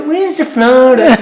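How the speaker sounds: high, loud, slow Dublin mimic; mock Dublin accent, slow and high. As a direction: tease a friend in her Dublin voice. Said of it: "I go".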